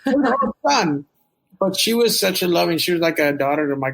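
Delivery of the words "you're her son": animated grandmother voice, pitched up; higher-pitched, insistent imitation of grandma; imitating grandmother. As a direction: play an emphatic grandma pleading her case. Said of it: "She used to love to tell me that story. She said".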